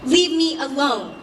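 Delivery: firm retort. A narrator's reading. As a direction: raise your voice and push back. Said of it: "I directed at him".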